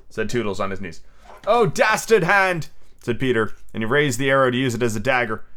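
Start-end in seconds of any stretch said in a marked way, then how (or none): 1.5-2.7 high, slow theatrical cry; dramatic condemnation. A narrator's reading. Peter raising the arrow at Toodles, outraged. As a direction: declaim it in melodramatic outrage.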